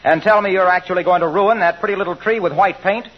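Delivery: normal talking voice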